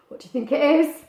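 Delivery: ordinary delivery, nothing marked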